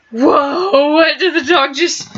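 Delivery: high, slowed, half-crying whoa; surprised. Real, not acted. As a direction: caught off guard by the dog. Startled reaction to the dog.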